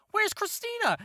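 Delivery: squeaky high panicked call for Christina; panicked question. Acted. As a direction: yelp it out high and scared. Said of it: "then he's like".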